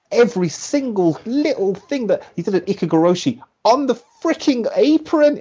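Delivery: high-pitched, emphatic exclamation; worked-up disbelief. Real, not acted. Fan recounting a brutal move on the apron, high worked-up disbelief. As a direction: voice climbing with each word.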